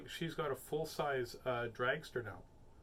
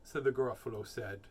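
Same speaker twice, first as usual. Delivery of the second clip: plain reading voice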